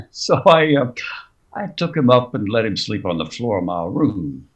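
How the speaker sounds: ordinary talk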